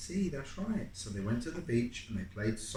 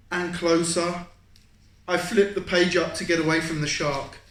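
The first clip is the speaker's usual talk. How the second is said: rising excitement; 6 semitones higher, 8 dB louder than usual. Acted.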